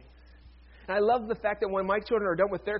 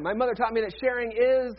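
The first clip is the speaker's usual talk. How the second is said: louder, higher fill-in-the-blank cue; raised voice, leading them to finish the sentence; repeated prompt; 4 semitones higher, 6 dB louder than usual. Shown as a demonstration. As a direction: prompt the crowd more forcefully, leaving IS hanging. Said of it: "Let's try it one more time".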